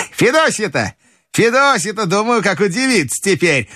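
high, excited grandmother; оживлённая бабушка. Acted. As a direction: exclaim high as an old lady. Grandmother exclaims, thinking of her nanny. (высоко, восторженно, голосом бабушки)

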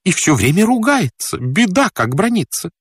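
high-pitched, complaining woman servant; fretting housekeeper. Acted. (причитающая служанка, высоким голосом)

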